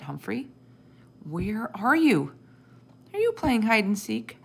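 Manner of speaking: lightly voiced child calling for a pet, drawn-out questions. A narrator's reading. Sophie searches for Humphrey, calling out. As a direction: sing out a puzzled call, lifting at the ends. Child calls for missing hamster.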